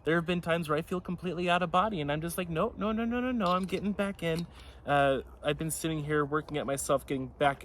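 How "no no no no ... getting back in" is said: self-correcting refusal. Retold or imitated. Acts out talking herself back in.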